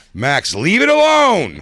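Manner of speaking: high, loud, slow drawn-out warning; exasperated mom. Retold or imitated. Mimicking the mom telling Max to drop it. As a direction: stretch it out high like a fed-up mother. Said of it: "Dawn just finally goes".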